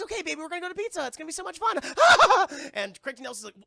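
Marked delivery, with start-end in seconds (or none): 0.0-1.8 very high, very fast excited mom voice; chirpy mom. Retold or imitated. Mother promising Pizza Hut, high giddy rush. 1.8-2.7 loud high laugh; burst of laughter. Real, not acted. Streamer cracking up at own impression, loud laugh.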